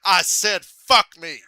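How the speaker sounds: loud curse, rising in force; angry yell. Retold or imitated. Tourette's Guy answering the caller, yelling. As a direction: yell the repeat. Replaying the phone gag.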